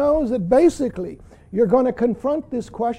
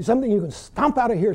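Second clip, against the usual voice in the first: usual speaking voice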